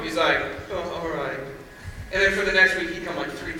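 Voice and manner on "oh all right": reluctant agreement. Retold or imitated.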